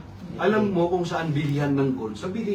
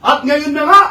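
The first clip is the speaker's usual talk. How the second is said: higher, louder, slow proclamation of scripture; preacher booming the verse; preaching at full force; 3 semitones higher, 6 dB louder, slower than usual. Acted. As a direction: proclaim it slowly to the church. Preacher proclaims Jesus' words.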